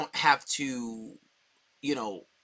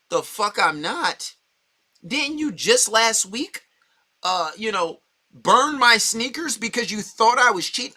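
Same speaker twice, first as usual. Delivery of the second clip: loud, high, slowed outrage; angry retort; 6 semitones higher, 7 dB louder than usual. Acted. Snapping back at a girlfriend who burned his sneakers, loud and outraged. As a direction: fire back at her loud and incredulous.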